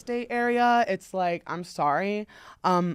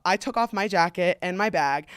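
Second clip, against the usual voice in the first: usual speaking voice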